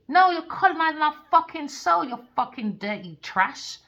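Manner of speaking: loud, high-pitched outburst, easing at the end; real heated rant; angry ranting. Real, not acted. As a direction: hurl it back as a furious accusation. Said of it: "Let me stop my ranting".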